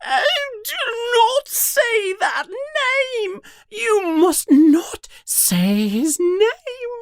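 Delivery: extremely high, drawn-out elf screech; panicked high creature wail. Acted. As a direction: shriek it slowly at the very top of your voice. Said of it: "begins screeching in a high voice".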